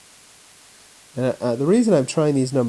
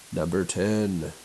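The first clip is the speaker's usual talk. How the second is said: low, slow, flat announcer read of the number; weird announcer voice; 5 semitones lower, 5 dB quieter, slower than usual. Real, not acted. Teacher reading out the next problem in a flat announcer voice. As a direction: announce it deadpan like a radio host. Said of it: "some sort of weird announcer voice".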